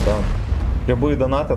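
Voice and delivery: ordinary talk, nothing marked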